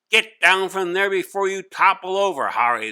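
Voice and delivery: teacher ordering Hari off his desk, stern; stern scolding. Acted. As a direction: order him down firmly.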